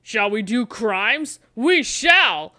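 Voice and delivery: ordinary talk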